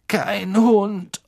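high, strained pained voice; groaning in pain. A narrator's reading. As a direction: squeeze it out through the pain. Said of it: "stöhnte Ron und biss vor Schmerz die Zähne zusammen". (vor Schmerz stöhnend)

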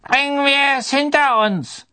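put-on high, thin old official's voice, projected; loud, reedy old voice; thin-voiced old committee member. A narrator's reading. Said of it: "sagte die dünne Stimme des alten Ausschussmitglieds".

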